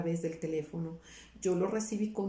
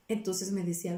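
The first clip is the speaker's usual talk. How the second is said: usual speaking voice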